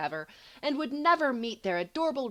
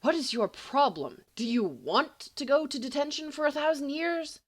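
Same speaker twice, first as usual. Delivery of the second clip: hoarse; 5 semitones higher than usual. Acted. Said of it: "Remus says hoarsely".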